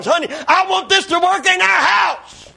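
high, emphatic imitation of a wife; mock wifely plea, climbing high; pleading wife. Preacher quoting his wife on the way home, high plea. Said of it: "she would tell me".

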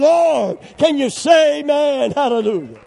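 loud, high call for an amen; rousing preacher shout. Real, not acted. Preacher to congregation asking for amens, rousing shout. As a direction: shout it out to stir up the church.

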